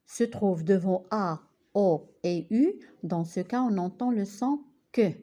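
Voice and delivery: ordinary talk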